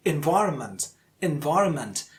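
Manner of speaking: environment with VI most prominent, falling after it; second-syllable stress. Shown as a demonstration. Teacher demonstrates the stressed syllable, punching VI in environment. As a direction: say environment as en-VI-ronment. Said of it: "the second syllable".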